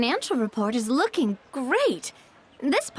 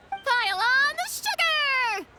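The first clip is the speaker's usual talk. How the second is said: high, gleeful chant; sing-song excited rallying cry; excited cheer; 8 semitones higher than usual. Acted. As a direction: cheer it out with glee.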